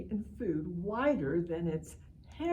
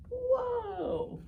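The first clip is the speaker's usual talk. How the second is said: a much higher, playful whoa; mock amazement. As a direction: sound astonished for the kids. Reacting to giant hot dog.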